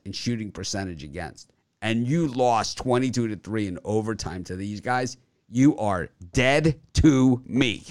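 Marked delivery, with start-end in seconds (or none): 5.5-7.8 mock coldness. Mock breakup with a team.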